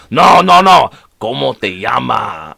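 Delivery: put-on Mexican-accented teacher correcting, loud no's then a slow model phrase. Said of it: "that was stereotypical, like, Mexican dude".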